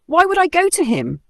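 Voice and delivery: high, scared squeak of a question; a frightened little voice; shuddering mouse. A narrator's reading. Mouse fears visiting the lion, high scared voice. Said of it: "the mouse shuddered".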